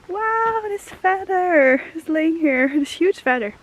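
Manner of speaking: sudden high wow, then slow; delighted surprise at a find; surprised. Real, not acted. Spots a huge feather.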